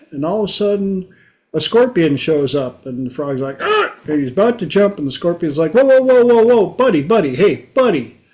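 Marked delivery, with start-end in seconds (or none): 3.6-3.9 a sudden high squawk of fright; startled frog yelp. Retold or imitated. 5.7-8.2 higher, louder hurried whoa whoa buddy; calming the frog. Retold or imitated. Scorpion stops frog from jumping.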